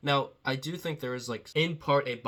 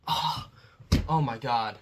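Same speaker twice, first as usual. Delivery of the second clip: mock-appalled gasp of disbelief; retold fake outrage, drawn out with a pause; pretend outrage; 3 dB louder, slower than usual. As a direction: act offended as a tease. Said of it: "pretend to have an outrage".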